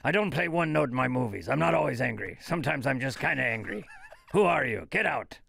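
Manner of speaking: Liam Neeson. Retold or imitated. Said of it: "is that Liam Neeson".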